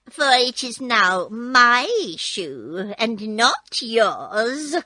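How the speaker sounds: slow, loud gloating witch. Acted. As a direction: drag it out like a spiteful witch. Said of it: "retorted the witch".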